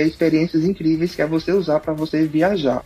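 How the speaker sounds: ordinary talk, nothing marked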